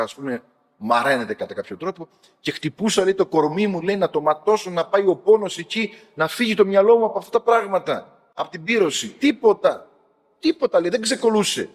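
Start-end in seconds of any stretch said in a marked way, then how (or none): 2.4-11.7 raised, higher-pitched agitated retelling; retold inner turmoil. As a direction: act out his desperate struggle, voice pitched up. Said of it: "μέσα εκεί στην αναστάτωση".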